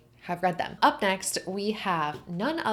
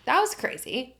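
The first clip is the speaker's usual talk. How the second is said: "That was crazy" said higher, with "was" falling hard; stunned disbelief at the ending; excited repeat; 6 semitones higher than usual. Real, not acted. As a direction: exclaim it, amazed at the ending. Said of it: "repeated with more force".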